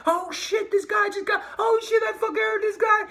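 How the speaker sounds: high, loud panic; imagined crash panic, yelled high; panicked shouting. Pretend. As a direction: shriek in horror at the crash. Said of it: "I'd be like".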